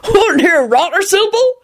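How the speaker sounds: high-pitched teasing rustic; amused. A narrator's reading. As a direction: tease her merrily. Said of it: "Hagrid shook with laughter".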